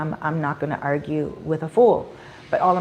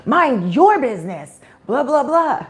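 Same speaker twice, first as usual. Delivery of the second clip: mock indignant retort, pitched up; negative foreigner; 6 semitones higher, 5 dB louder than usual. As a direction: imitate a rude foreigner snapping back, high and loud. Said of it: "a negative foreigner would be like".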